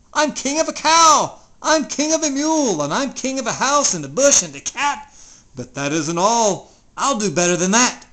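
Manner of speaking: high, loud boasting; thundering royal voice. Acted. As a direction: rule over Mac with your voice. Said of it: "his royal voice thundered".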